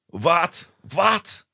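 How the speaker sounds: high mock German accent, laughing. Imitating rude German hotel guests, high mock German accent. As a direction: squeak What? What? in a German accent. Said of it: "I won't do the accent".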